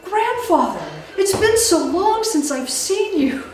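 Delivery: high, gushing long-lost granddaughter greeting; woman's feigned joyful greeting. Acted. Woman greets the monster as her grandfather, high gushing welcome. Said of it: "she turns around and she goes".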